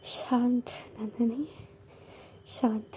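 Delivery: hushed, tense words to herself; soft self-soothing while holding anger in; self-calming. A narrator's reading. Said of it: "गुस्से में उबल रही थी नंदिनी". (धीमे से खुद को शांत करते हुए)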